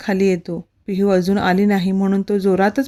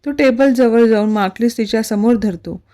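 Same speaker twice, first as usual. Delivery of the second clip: plain reading voice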